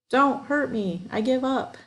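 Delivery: high, small pleading voice; peeping. A narrator's reading. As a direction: squeak it out, fake-scared. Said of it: "Roy peeped convincingly".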